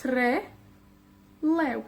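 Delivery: Trelew split by a long pause; slow Trelew as two stressed words; two separate words. Shown as a demonstration. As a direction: say TRE, stop, then LEW for Trelew. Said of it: "son en realidad dos palabras".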